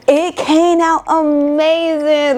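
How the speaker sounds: drawn-out thrilled praise; excited. Real, not acted. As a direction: savor how well it came out. Excited about result.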